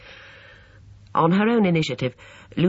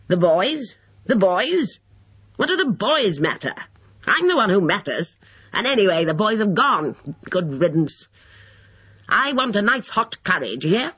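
put-on high, crotchety old man's outburst; peevish, self-important elderly tirade; indignant old man; 7 semitones higher than usual. A narrator's reading. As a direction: insist crossly, voice pitched high.

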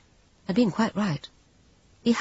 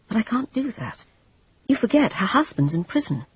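higher voiced Jane, gently protesting; a higher, anxious Jane; pleading Jane; 8 semitones higher than usual.

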